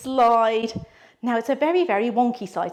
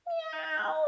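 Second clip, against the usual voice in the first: cat meow; 7 dB quieter than usual. Acted. Cat wants stroking.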